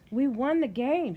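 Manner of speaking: excited. A narrator's reading. Girl boasts of her win.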